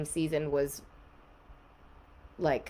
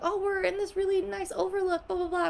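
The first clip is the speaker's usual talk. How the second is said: much higher, airy carefree tone; mock breezy self; 14 semitones higher than usual. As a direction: toss it off like a sightseeing brag. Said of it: "I was like".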